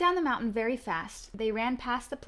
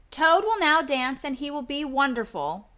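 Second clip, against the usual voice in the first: announcer voice; 4 semitones higher, 9 dB louder than usual. Acted. Strange voice announcing Toad's dance. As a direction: proclaim the dance grandly. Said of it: "said the voice".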